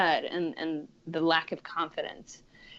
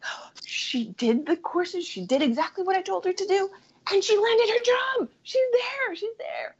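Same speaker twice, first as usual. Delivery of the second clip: thrilled; 13 semitones higher than usual. Retold or imitated. Quoting herself, thrilled about client.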